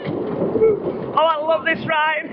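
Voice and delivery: ordinary talk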